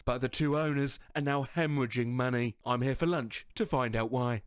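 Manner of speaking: lightly voiced angry Scottish chef; a hint of a scolding Gordon Ramsay; Gordon Ramsay impression. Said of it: "something Ramsay would do".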